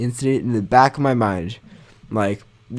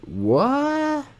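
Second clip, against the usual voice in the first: high, squeaky what; 13 semitones higher, 6 dB quieter than usual. Retold or imitated. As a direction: squeak it out in disbelief. Classmate's squeaky disbelief.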